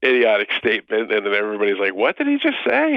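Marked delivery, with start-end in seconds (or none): 1.9-2.9 mock incredulous.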